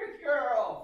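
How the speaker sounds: high, sing-song praise; high-pitched praise. Shown as a demonstration. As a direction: lift the praise way up. Praising dog.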